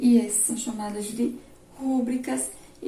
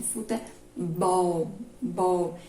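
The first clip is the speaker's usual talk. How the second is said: futebol stretched out, then "bol" repeated alone; slow futebol, weight on "bol", echo that syllable; stress on last syllable; 4 semitones lower, much slower than usual. Shown as a demonstration. Teacher showing pupils the strongest syllable, "bol" stressed and repeated. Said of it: "é a mais forte".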